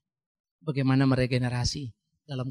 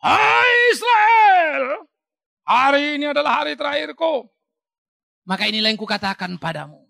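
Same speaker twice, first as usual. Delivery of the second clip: high, loud commanding shout to the people; Joshua's farewell speech; 9 semitones higher, 9 dB louder than usual. Acted. Joshua addressing all Israel on his last day, loud proclamation. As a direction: proclaim it like an old leader's last charge. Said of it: "kalian tahu apa pidato Yosua?".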